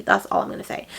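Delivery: whispered, unvoiced, keeping the secret; hushed aside. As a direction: breathe it out like a secret. Secretive hint about spoilers.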